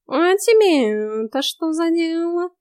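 high, slow lazy cat voice; snooty bored cat. Acted. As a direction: drawl it like a smug cat. Cat voice in the story. (высокий ленивый кошачий голос)

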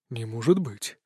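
low, softened shock; breathed-out disbelief. A narrator's reading. God seeing an old acquaintance, soft breathed-out disbelief. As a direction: let it out on a breath, softly. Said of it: "выдохнул он".

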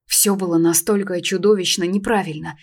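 ordinary delivery, nothing marked

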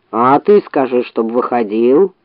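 low, slow bear grumble; grumbling. Acted. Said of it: "проворчал медведь".

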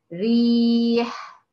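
ريح drawn out very slowly, its light ra held; slow model reading of ريح; tajweed demo word. Shown as a demonstration. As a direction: stretch ريح out slowly to show the thin ra. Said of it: "زي كلمة".

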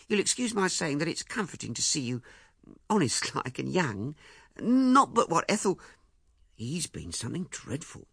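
lightly voiced fussy mother; a hint of a flustered older woman; Mrs Chaffrey's voice. A narrator's reading.

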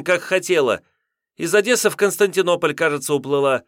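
high, loud, heated outburst; agitated young woman. A narrator's reading. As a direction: fire it back, worked up.

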